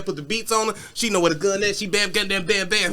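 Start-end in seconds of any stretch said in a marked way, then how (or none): none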